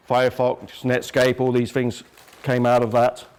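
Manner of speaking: usual speaking voice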